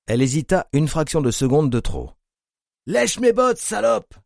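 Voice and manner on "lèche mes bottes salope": bellowed. A narrator's reading. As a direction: roar the command down at her. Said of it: "vociféra l'homme".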